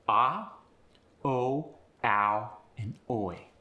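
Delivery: AH, OH, OW, OI each with an upward glide; upward pitch. Shown as a demonstration. Coach listing sounds like AH and OI, upward pitch. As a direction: lift the pitch through AH and OH. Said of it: "get an upward pitch".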